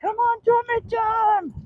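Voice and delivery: very high, drawn-out, soft coaxing call; calling the dog. Real, not acted. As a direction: coax your dog along way up high, stretched out.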